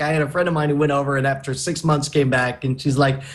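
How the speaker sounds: usual speaking voice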